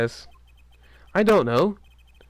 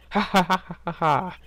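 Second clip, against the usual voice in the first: villain laugh; 5 semitones higher than usual. Acted.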